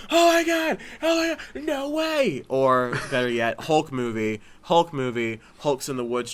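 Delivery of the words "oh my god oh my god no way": very high, loud excited screams; screaming fans. Retold or imitated. Fans at the credit scene screaming in disbelief. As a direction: squeal it out like a fan losing their mind. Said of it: "Everyone starts, like, screaming".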